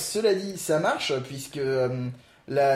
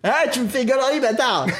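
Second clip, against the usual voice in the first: higher-pitched playful insult breaking into laughter; mock outrage; 7 semitones higher, 3 dB louder than usual. Host jokingly insults co-host over mangled wordplay, mock outrage. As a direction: burst out in joking indignation, pitched up.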